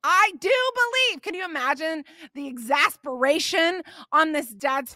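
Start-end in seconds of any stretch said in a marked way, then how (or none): 0.0-1.2 high yelled plea of a desperate father. Acted. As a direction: shout it up high, begging. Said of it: "He yelled".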